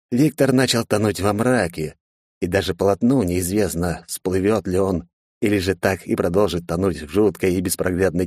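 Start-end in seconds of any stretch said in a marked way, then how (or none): none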